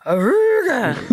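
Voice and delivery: high, slow mock horn blare; goofy imitated car horn; car horn honk. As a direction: honk it like an old jalopy horn. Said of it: "and then he honks".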